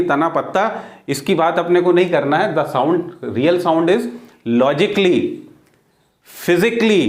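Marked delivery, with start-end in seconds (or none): 4.6-5.2 "logically" said as lo-ji-kli, al silent. Shown as a demonstration. Teacher models "logically" for students, silent a. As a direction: clear model "logically" for the class. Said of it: "not physically".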